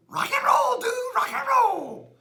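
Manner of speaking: high, loud whooping cheer; wild cheer. Acted. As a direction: shout it like a rock fan. Wannabe cheering wildly.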